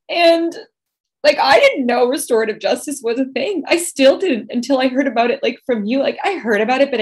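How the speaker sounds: high, strained voice near tears; holding back tears while telling it; emotional. Real, not acted. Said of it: "I'm gonna get emotional".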